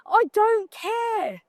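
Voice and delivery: slow, drawn-out I don't care. Acted. Shrugging off a bad review to the audience, slow and drawn out. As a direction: stretch every word of I don't care out lazily.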